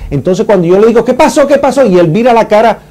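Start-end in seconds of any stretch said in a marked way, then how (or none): 1.0-1.9 alarmed question. Retold or imitated. Survivor retells asking in panic.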